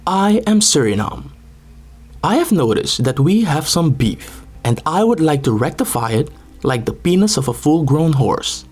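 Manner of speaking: normal talking voice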